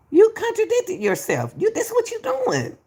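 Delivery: exasperated high pitch. Real, not acted. Streamer calls out Sadie for contradicting herself, in high exasperated pitch. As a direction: voice jumps high in disbelief.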